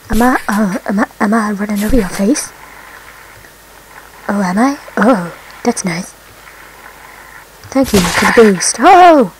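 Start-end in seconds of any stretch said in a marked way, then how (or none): none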